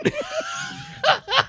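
high shrieking laugh; cracking up loud and high; real howl of laughter; loud burst of laughter. Real, not acted. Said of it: "Oh my God".

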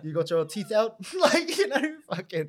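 high, loud laughing talk. Real, not acted. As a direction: laugh through the words. Laughter heard.

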